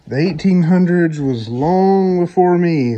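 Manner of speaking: high, loud, playful exclaim; excited joke. Real, not acted. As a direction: blurt it out amused. Joking exclaim about old house.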